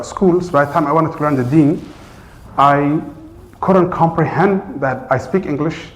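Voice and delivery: ordinary delivery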